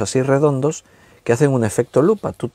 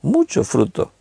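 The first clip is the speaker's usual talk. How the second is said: "mucho" pitched high, "fruto" dropping after it; stress on MUCHO; 6 semitones higher than usual. Shown as a demonstration. Preacher corrects "not just fruit", lifting "mucho" high. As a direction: punch "mucho" high against plain "fruto". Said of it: "No solo fruto".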